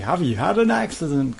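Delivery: high put-on cold-caller voice; mock spam caller. Ranting about spam calls, mock cold-caller voice. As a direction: mimic the nuisance call script.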